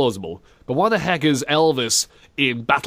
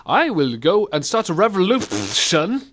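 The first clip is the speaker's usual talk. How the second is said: slow, higher hammed-up Russian accent; mock Russian lady; 5 semitones higher than usual. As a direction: declare it like a Russian revolutionary, spitting consonants. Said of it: "I am a lady from Russia".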